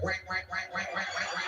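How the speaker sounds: repeated quacky "wank, wank"; duck-like quack. Retold or imitated. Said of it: "neighbors think we have ducks".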